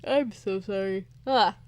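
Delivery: words through a yawn; stifling a yawn mid-line. Real, not acted.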